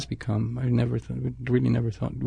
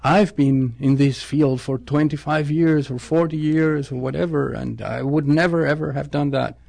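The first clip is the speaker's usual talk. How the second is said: imitating indignant veteran; 3 semitones higher than usual. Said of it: "they bang on the table and they say".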